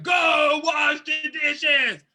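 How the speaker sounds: slow, loud shouted command; harsh shouted order. Shown as a demonstration. Showing how the chore request lands on a sensitive person, shouting slowly. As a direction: bark each word slowly like an angry parent. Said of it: "if it comes like hell to that person".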